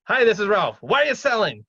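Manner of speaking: loud, high-pitched blunt phone opener; tactless blurting. Acted. Investor cold-calling an owner with no tact, blunt and loud. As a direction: pounce on the owner with zero tact. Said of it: "You can't just, like".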